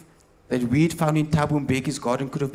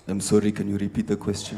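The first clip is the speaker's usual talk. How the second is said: much lower, soft evasive voice; lower, softer, lightly voiced Mbeki; Mbeki answering; 7 semitones lower, 5 dB quieter than usual. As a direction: stall the reporter, deadpan. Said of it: "Mister Mbeki".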